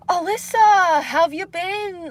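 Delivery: high, drawn-out cheery coworker voice; Kevin's greeting. Acted. Imitating Kevin greeting her at work in a high cheery voice. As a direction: mimic an overly bright coworker calling her name.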